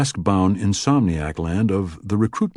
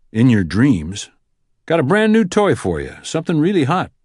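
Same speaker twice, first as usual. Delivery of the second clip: teasing boss, a touch higher; lightly voiced boss; 7 semitones higher, 4 dB louder than usual. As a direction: play the wry boss lightly. Sykes teasing Alex.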